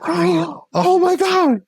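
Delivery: drawn-out, high breathless awe; awed. Retold or imitated. Imitating a partner overwhelmed by sudden sexual energy. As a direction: gasp it out like an awakening. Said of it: "It's like".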